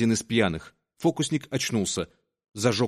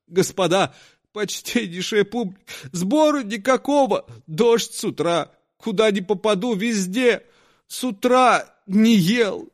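crying; 8 semitones higher than usual. Acted. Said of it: "и вдруг заплакал".

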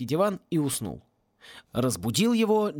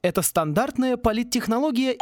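high, wounded self-defence; insist, pitched up, as if insulted; huffy justification; offended; 8 semitones higher than usual. A narrator's reading. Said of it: "обиделся Сен".

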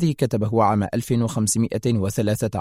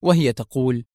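usual speaking voice

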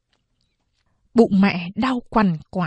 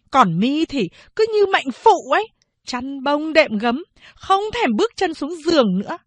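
higher-pitched, nagging mother-in-law; scolding mother-in-law; 6 semitones higher than usual. Acted. Mother-in-law orders new mother out to the threshing yard, scolding. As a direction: berate the daughter-in-law with rising scorn.

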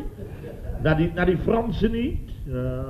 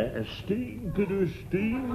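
hushed emphasis; 5 semitones higher, 9 dB quieter, slower than usual. Acted. Chef lowers voice on the smell.

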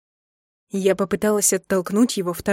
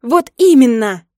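loud, higher-pitched outburst; yelling; 4 semitones higher, 10 dB louder than usual. A narrator's reading. Wife finally snaps at her husband in the bathroom, yelling. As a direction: yell it out slowly and angrily. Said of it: "заорала я".

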